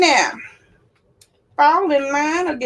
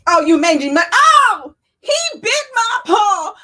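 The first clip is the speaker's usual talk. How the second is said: high crying yelp; lion yelping, high and crying; yelp of pain; 8 semitones higher, 3 dB louder than usual. Acted. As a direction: wail that he bit your paw. Lion bitten, cries out.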